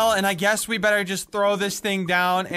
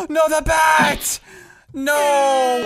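shocked gasp; 7 semitones higher, 7 dB louder, much slower than usual. Real, not acted. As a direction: gasp then loud cry of dismay. Bat falls into lava.

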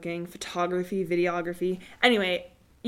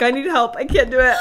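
loud, higher, comic plea; mock despair; 5 semitones higher, 10 dB louder than usual. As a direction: wail it in playful self-horror. Retells despair at own accent.

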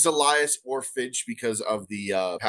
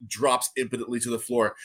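ordinary talk, nothing marked

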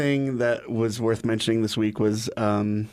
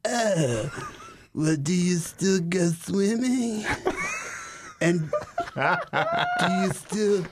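cousin voice; 9 semitones higher, much slower than usual. Retold or imitated. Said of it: "she's like".

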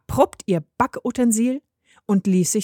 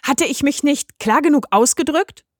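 higher-pitched indignant rebuke; mildly angry scolding; 6 semitones higher, 4 dB louder than usual. A narrator's reading. Mechthild scolds the returning Mirko, mildly indignant. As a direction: scold with a touch of outrage.

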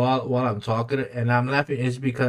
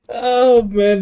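laughing; 10 semitones higher, 6 dB louder, slower than usual. Real, not acted. Laughing about the underwear scene.

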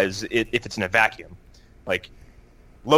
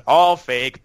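louder, direct put-down of ALL FAKE; blunt dismissal; 5 dB louder than usual. She knocks it over, bluntly dismissive, ALL FAKE. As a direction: declare ALL FAKE flatly outright, punched loud. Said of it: "like, how direct it is".

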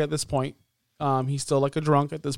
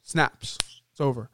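ordinary talk, nothing marked

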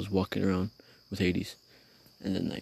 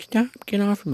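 loud, very high mock shriek; hammed-up movie scream; mock women's screaming; 13 semitones higher, 7 dB louder, slower than usual. Mocking women screaming in movies, high shriek. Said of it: "screaming like those bitches".

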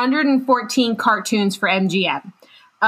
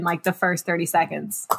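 ordinary talk

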